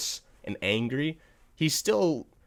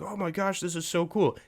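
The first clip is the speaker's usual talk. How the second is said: fan excitement; 7 semitones higher than usual. Retold or imitated. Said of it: "and saying, like".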